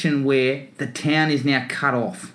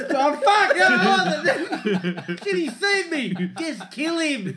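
high, flustered comic voice; panicked goofy predator; 11 semitones higher, slower than usual. Retold or imitated. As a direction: caught out and flustered.

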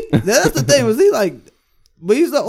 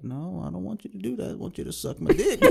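put-on creepy old geezer; 13 dB quieter than usual. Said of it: "He's using, like, the creepy old geezer voice".

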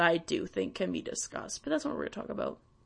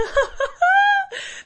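high, loud disbelieving laugh; a sudden loud laugh breaking into the rant; burst of laughter; 10 dB louder than usual. Real, not acted. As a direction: crack up at the memory. Bursts out laughing mid-story.

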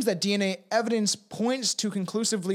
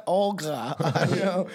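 laughing; slower than usual. Real, not acted. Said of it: "Oh, Olga".

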